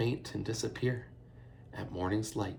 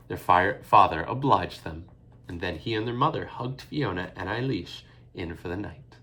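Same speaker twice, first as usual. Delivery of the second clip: usual speaking voice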